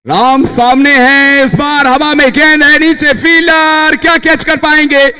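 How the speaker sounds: rising excited call as the ball goes up; high, urgent play-by-play; excited cricket commentary. Real, not acted. Ball in the air, catch chance.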